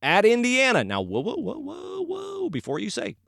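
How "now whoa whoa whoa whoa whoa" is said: mock calming whoas. Calming an imagined listener.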